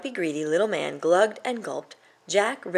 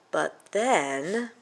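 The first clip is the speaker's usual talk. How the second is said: ordinary delivery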